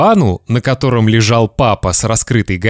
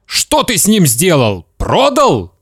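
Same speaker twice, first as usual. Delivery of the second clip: high, agitated questioning; father's shout; 7 semitones higher than usual. Acted. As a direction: demand it, rising to a cry. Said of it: "закричал папа".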